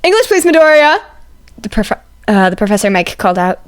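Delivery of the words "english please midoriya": loud teacher call. A narrator's reading. Professor Mike calling out.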